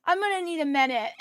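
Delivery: hammed-up sniffly toddler giving in; imitated tearful toddler. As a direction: play a worked-up two-year-old. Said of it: "She starts crying again".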